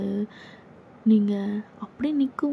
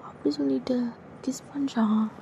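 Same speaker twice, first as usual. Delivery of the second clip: ordinary delivery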